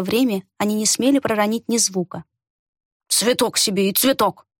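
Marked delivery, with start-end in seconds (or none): 3.1-4.5 feigned indifference grumble. A narrator's reading. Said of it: "сделанно равнодушно пробурчал старик".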